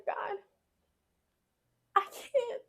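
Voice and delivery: retold plea, high and strained; desperate cry to God. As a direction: cry out to God at the end of your rope. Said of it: "I said".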